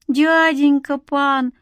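put-on high, drawn-out pleading kid; pitiful child's plea, stretched out; whining child. A narrator's reading. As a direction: whine like a miserable little beggar boy. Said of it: "прогнусил несчастный ребячий голос".

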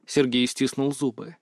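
usual speaking voice

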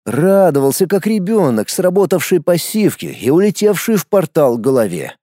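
ordinary delivery, nothing marked

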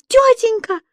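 high, loud desperate yell; stuck girl screaming to the dispatcher. A narrator's reading. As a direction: scream for help into the panel. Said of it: "заорала девочка".

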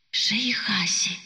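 slow whispered incantation. A narrator's reading. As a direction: breathe the spell under your breath. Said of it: "прошептала она себе под нос". (шёпот себе под нос)

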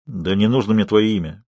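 ordinary delivery, nothing marked

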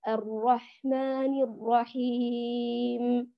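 slow chanted recitation, steady lengthening; slow tajwid chant of the opening verse; Quran recitation. Shown as a demonstration. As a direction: recite slowly with even madd. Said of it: "بذات المقدار".

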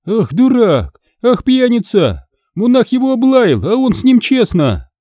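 shrill scolding wife. Acted. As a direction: rail at the monk like a furious peasant wife. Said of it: "накинулась на мужа".

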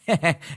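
sycophantic little chuckle; fawning laugh. Acted. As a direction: laugh along to please the boss. Chief laughs along.

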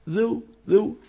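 ذو with a muddy, unclear consonant; indistinct sound. Shown as a demonstration. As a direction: say ذو so the first sound is hard to identify. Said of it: "صوت مو معروف شو هذا". (ذو بصوت غير واضح)

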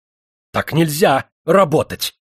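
slow, heated outburst. A narrator's reading. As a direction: spit it out hotly and slowly. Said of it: "горячась заговорил он".

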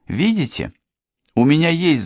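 lively mock-formal announcement, starting high; triumphant. A narrator's reading. Daughter shows off her protector, triumphant. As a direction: crow a little, then toss it off. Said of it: "Дженни торжествующе рассмеялась".

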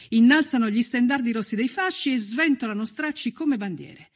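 ordinary talk, nothing marked

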